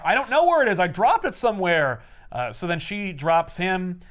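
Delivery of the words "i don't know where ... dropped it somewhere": higher, louder frantic pleading; panicked kid. Retold or imitated. Ben grabbed by the monster, panicked and higher. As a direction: blurt it out in a panic. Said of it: "and he's like".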